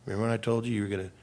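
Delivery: dad softly reminding his crying daughter; gentle quiet reminder. Retold or imitated. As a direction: ease into it softly. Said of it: "And I said".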